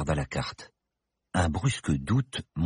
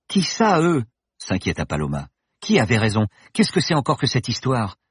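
put-on high, louder, worried young woman; anxious young woman; 7 semitones higher, 6 dB louder than usual. Paloma demands an explanation from the professor, anxious. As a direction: press him with rising worry.

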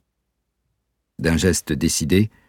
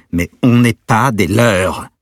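slow, much louder, higher, every word hammered; very angry; 5 semitones higher, 7 dB louder, slower than usual. Acted. Peter answering the man who kidnapped his girlfriend, slow furious shout. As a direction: spell it out in cold fury. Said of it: "à présent très en colère".

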